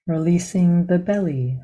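calm, measured meditation guidance with long pauses; guided meditation. Real, not acted. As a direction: guide them gently into the body, leaving silence between phrases.

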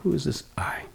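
whispered question. Real, not acted. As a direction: breathe the question out.

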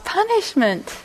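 high, stressed "punishment"; emphatic confirmation. Real, not acted. Confirming shocking definition.

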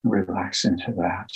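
slow, hushed meditation guide with long pauses; guided meditation. Acted. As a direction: ease listeners into calm, phrase by phrase, leaving long silences.

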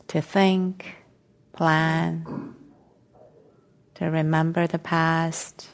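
slow meditation guidance with long silences between phrases; guided meditation. Real, not acted. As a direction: ease them into stillness, phrase by phrase, unhurried.

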